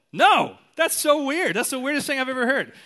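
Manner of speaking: loud, high mock outburst; John MacArthur. Colleague rejecting a preacher's emotional confession, mock loud outburst. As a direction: blurt it out, drawing out the NO. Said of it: "He's all".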